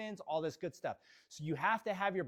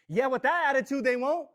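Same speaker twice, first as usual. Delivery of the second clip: louder, higher comeback; indignant retort; 5 semitones higher, 5 dB louder than usual. Retold or imitated. Trainer retelling their answer to a doubter, indignant and loud. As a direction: fire back at the doubter, raised in pitch and volume.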